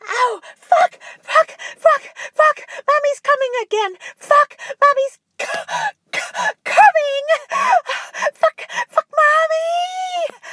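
high, loud, ecstatic shouts; shouting at climax. Acted. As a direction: scream it out at the peak. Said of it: "Finally, she shouted".

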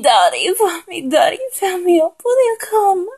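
high-pitched, pleading voice; begging. Acted. Acting out a lover begging the speaker, high pleading voice. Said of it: "Begging me over and over".

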